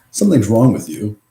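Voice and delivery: normal talking voice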